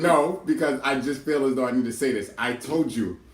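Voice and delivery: high-pitched, emphatic self-justification; heated insistence. Real, not acted. Sibling insisting "I told you" about how a situation was dealt with, heated. Real dispute, insists on explaining herself.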